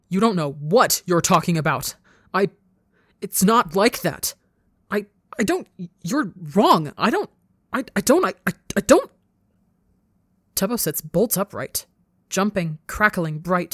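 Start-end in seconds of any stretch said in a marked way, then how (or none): 0.1-9.1 panicked yell. A narrator's reading. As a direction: yell it, breaking into frantic stutters. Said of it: "as he yells".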